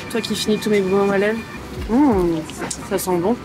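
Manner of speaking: ordinary talk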